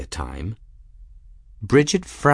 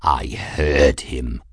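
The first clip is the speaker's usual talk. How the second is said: low, slow snarl; snarling cat; 8 semitones lower, much slower than usual. A narrator's reading. Insulted cat to his mistress, snarling. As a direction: snarl it slowly, deep down. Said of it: "Raoul snarled".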